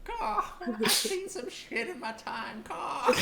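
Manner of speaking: put-on high, wistful old bird. Old bird telling heroes about his tower, high voice.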